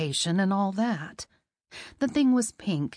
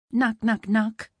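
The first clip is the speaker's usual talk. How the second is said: knocking sound; 3 dB louder, slower than usual. Acted. Knocking at the door.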